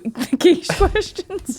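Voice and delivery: words broken by laughter; laughing. Real, not acted. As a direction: laugh through the phrase.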